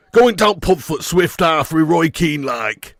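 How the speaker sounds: slow, broad Yorkshire pub talk; Yorkshire accent. Acted. Streamer hamming up a Yorkshireman off to the pub, broad Yorkshire accent. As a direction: put on a thick Yorkshireman's dialect. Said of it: "Proud Yorkshireman Mick McCarthy".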